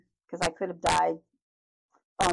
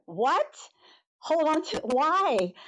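higher, alarmed "what?"; startled surprise; 8 semitones higher, slower than usual. Retold or imitated. Patient to nurse about bending over, startled and higher. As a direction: react as if the request is absurd, pitched up.

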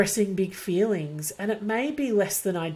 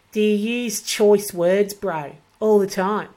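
louder, slangy Aussie bogan; mock Aussie bogan, louder; bogan slang; 5 dB louder than usual. Shown as a demonstration. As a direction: throw in a broad Aussie bro. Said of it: "talk like a bogan".

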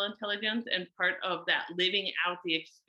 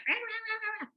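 much higher mock rant of rah sounds; angry rah noises. Mom voicing her own angry ranting at Luna, mock rah sounds. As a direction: sputter out a cartoon rant. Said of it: "I could feel myself still being like".

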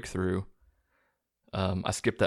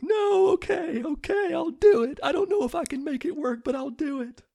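panicked giving in. Retold or imitated. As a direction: yell out a desperate high-pitched give-up. Kid gives in to torture threat.

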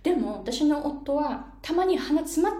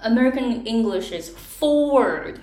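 quoting Americans; 5 dB louder, slower than usual. Retold or imitated. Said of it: "ってすごく言うんですよね".

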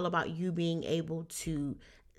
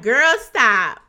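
louder, laughing brush-off of a skeptical friend; sassy disbelief; 5 semitones higher, 12 dB louder than usual. Retold or imitated. Doubtful listener laughing off big money claims, loud sassy dismissal. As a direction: wave it away like it's a joke. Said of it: "You're like".

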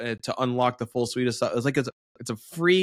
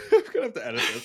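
laughing; 11 semitones higher, much faster than usual. Real, not acted. Laughing at own chewing mishap.

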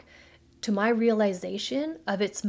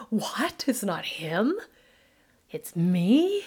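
stunned realization; 4 dB louder, much slower than usual. Retold or imitated. Retelling slap in the face.

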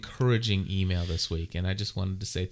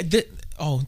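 normal talking voice

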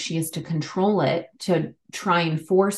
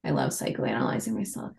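muttered aside; 4 semitones lower, 7 dB quieter, faster than usual. Real, not acted.

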